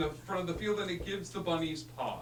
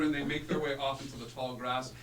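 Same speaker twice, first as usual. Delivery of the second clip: normal talking voice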